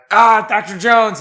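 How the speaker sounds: high, loud gushing fan; excited fan. Retold or imitated. A fan greeting Harrison Ford, high loud gush. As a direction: squeal it like a starstruck fan. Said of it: "you went like".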